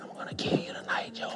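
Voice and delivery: imitated creepy night whisper; very soft, voiceless menace; whispered threat. Cellmate's threat repeated at night.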